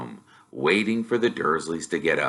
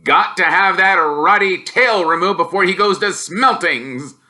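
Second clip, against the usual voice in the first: loud, higher gruff uncle voice; grumbling Uncle Vernon; growling uncle; 9 semitones higher, 5 dB louder than usual. Acted. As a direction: growl it out grumpily.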